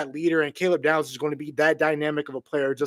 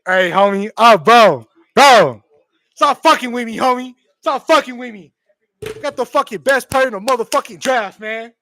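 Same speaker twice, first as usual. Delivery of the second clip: loud, higher-pitched thrilled hollering; excited yelling; 6 semitones higher, 10 dB louder than usual. Real, not acted. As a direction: yell it, giddy with disbelief. Fan yelling about pick.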